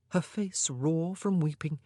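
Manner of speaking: ordinary delivery, nothing marked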